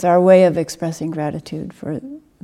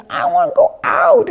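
put-on very high wailing child's voice, louder; wailing toddler; 6 dB louder than usual. Teacher imitating the toddler wailing at her dad. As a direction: wail harder like the toddler. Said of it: "starts wailing harder".

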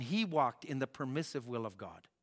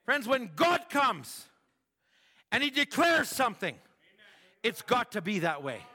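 emphatic preaching; 7 semitones higher, 6 dB louder than usual. Real, not acted. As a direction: lean on every word.

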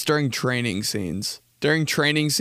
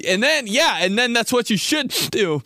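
higher, mock worked-up yelling; cracking yell; 9 semitones higher than usual. Comedian imitates himself trying to yell, high cracking voice. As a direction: push the voice up until it breaks. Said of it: "every time I yell, I'm like".

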